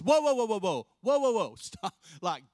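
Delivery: loud, high panicked "whoa whoa" to stop someone; frantic objection. Retold or imitated. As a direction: rush in yelling to halt him. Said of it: "runs up to Jesus and says".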